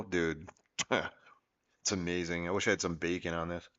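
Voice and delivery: talking with mouth full; chewing between words; wolfing down food. Real, not acted. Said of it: "If you hear this through the mic, I apologize".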